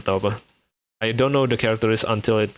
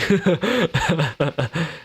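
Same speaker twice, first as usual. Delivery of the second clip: laugh; 8 semitones higher than usual. Real, not acted. Streamer laughing at chat.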